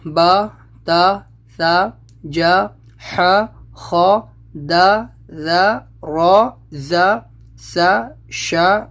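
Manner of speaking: each Arabic letter sounded slowly with a short a; slow, careful reading of the letters with zabar for a child; slow letter recitation. Shown as a demonstration. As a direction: drill the alphabet slowly, one sound at a time. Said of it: "تو آواز بس ایسی ہو جائے گی".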